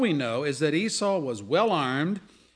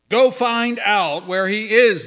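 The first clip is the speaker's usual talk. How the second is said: louder, slow royal command; commanding order; 6 semitones higher, 10 dB louder, slower than usual. Acted. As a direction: give the order like a king. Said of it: "the king ordered".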